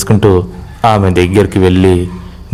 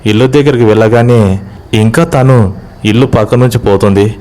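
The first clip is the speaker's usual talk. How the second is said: usual speaking voice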